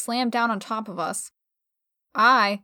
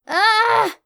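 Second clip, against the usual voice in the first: sharp pained gasp; choked cry; 10 semitones higher, 5 dB louder than usual. Acted.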